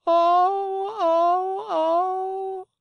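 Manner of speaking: loud, very high drawn-out "oh" wails; hammed-up sobbing howl; long childlike wails of despair; high wailing cry.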